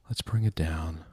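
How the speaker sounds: usual speaking voice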